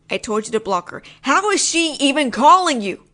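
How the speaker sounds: usual speaking voice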